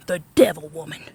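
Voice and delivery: low, scornful preacher-like cry; brand her the devil woman to the crowd; accusing mob leader. A narrator's reading. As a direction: Trout denouncing the schoolteacher to the mob. Trout denouncing Catherine to mob.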